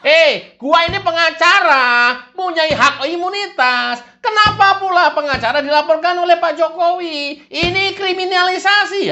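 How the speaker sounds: high, loud mock outrage; mock indignant protest. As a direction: play it up as a righteous protest.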